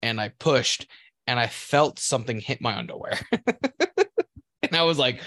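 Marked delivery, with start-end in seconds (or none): none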